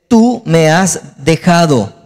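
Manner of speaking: very slow, word-by-word reading; solemn accusation. Acted. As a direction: pronounce it as a weighty divine reproach. Reads God's accusation from scripture.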